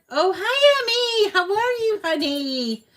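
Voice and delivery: light up at seeing a friend arrive; excited greeting. Real, not acted. Streamer greeting viewer Emmy who just joined, high and gushing.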